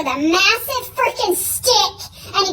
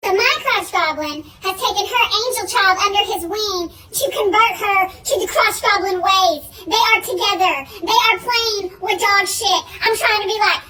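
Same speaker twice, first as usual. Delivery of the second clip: normal talking voice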